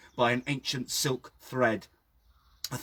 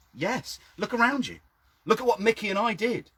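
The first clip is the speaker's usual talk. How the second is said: high, louder defiant reply; defiant retort; 10 semitones higher, 3 dB louder than usual. A narrator's reading. Narrator answering the old man, defiantly raised. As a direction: throw it back at him, proud and higher.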